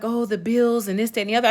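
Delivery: high, put-on voice listing the bills; mocking Nancy. Mechanic quoting Nancy's bill complaints, high mocking voice. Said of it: "she was just like".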